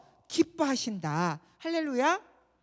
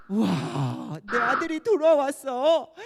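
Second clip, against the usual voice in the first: overjoyed father; 7 dB louder, slower than usual. Acted. Father welcomes returning son.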